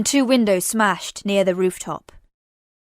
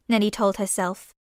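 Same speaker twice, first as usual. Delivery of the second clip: ordinary delivery, nothing marked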